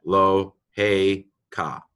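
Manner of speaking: "Eloheka" drawn out slowly, in separate syllables; slow teaching demo of "Eloheka", broken into syllables. Shown as a demonstration. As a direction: sound out "Eloheka" very slowly, pausing between syllables. Teacher sounds out the word.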